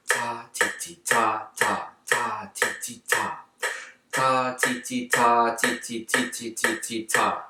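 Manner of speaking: soft building to loud and high. Shown as a demonstration. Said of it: "start softly and get louder and louder".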